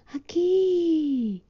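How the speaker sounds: high, drawn-out call. Acted. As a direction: shout into the dark. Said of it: "volvió a gritar".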